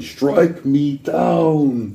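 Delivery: slow, slipping toward a mock Arnold; nearly goes Arnold on it; a hint of an action hero threatening; half Arnold voice. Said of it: "I almost went Arnold there".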